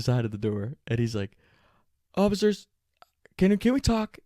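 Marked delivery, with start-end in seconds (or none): 2.1-4.2 high, loud imitation of an appeal; calling out. Griff leans out of the van to police, calling out. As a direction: call pleadingly to the officers, voice pitched up.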